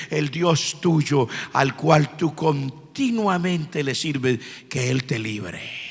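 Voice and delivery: low, soft, slow, fading to near silence; sorrowful royal blessing. Retold or imitated. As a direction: bless him softly, as the grieving king. King blesses Daniel at den. (bendición triste y queda del rey)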